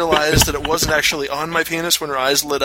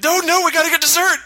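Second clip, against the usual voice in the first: high, loud frantic plea; husband frantically insists on dessert before proposal, panicked; panicky insistence; 4 dB louder than usual. Retold or imitated. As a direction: pitch up in mock panic for dessert. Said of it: "I'm like".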